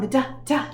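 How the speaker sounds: "da, da" as short voiced d sounds; D sound demo. Shown as a demonstration. Teacher shows children the letter sound, crisp d. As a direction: tap out "da, da" with a crisp d. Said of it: "you can make the D sound".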